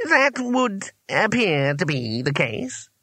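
high, slow, stiff concession; pompous old wizard. A narrator's reading. Trapped wizard conceding to Rincewind, pompous. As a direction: draw out each word with dignity.

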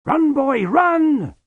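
high, loud urgent shout. Acted. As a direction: yell at the boy to run. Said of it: "shouted Lutzer".

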